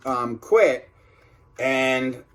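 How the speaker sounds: plain reading voice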